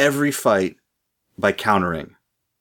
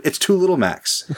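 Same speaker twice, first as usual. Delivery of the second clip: excited; 9 semitones higher than usual. Real, not acted. Excited punchline about two Little Macs.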